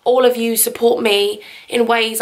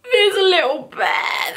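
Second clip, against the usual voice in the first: cooing; 7 semitones higher, slower than usual. Real, not acted. Cooing over a bear.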